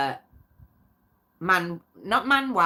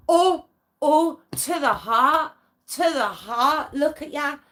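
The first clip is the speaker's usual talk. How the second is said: hammed-up hurt, higher, louder and slow; mock wounded; 6 semitones higher, 3 dB louder, slower than usual. Teasing a viewer who wounded her, with mock hurt. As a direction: clutch your chest playfully, as if stabbed.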